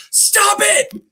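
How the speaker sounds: sharp high shout; yelled stop it. Retold or imitated. Doctor yells to stop the patient's story, high shout. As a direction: cut her off with a yell. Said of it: "he just yells at her".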